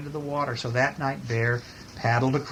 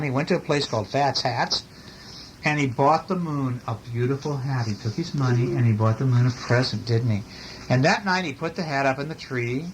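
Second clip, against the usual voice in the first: usual speaking voice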